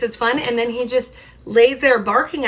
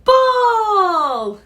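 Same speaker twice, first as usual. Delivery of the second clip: high, loud cry falling at the end; excited dog; 10 semitones higher, 6 dB louder than usual. Acted. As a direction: shout it out, long and high.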